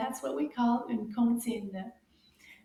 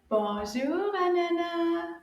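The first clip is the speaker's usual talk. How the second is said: slightly raised, drawn-out greeting for a class; a bit louder; 6 semitones higher, 3 dB louder, slower than usual. Shown as a demonstration. Teacher asks children to greet Anana louder, a bit louder. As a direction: call the greeting out a little louder. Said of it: "say that a little bit louder".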